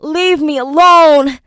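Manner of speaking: high, loud, slow wail. Acted. Enoch, burning inside, wailing at his captors. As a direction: cry out in pain and terror. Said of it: "Enoch wailed".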